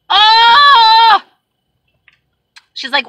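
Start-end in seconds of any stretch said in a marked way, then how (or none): 0.1-1.2 long, high, loud mock wail; exasperated cry. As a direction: let out a drawn-out frustrated "oh". Exasperated outburst in retelling.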